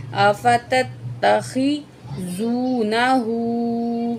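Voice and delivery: آفا تت تخی زو نا ہو very slowly, syllable by syllable. Shown as a demonstration. Teacher shows the hard word slowly to students. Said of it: "یہ الفاظ تھوڑا مشکل ہے، اسے دیکھیں".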